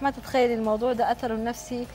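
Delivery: normal talking voice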